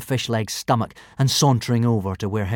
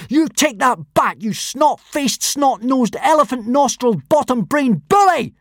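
furious yell; 6 dB louder than usual. A narrator's reading. Boy losing his temper at the bully, yelling. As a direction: punch out each insult, yelling. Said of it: "yelled Hiccup".

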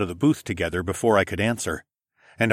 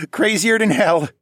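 high, loud words after a chuckle; laugh, then say it through the laugh; 12 semitones higher, 5 dB louder than usual. A narrator's reading. Dad agreeing with his son, laughing. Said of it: "he laughed".

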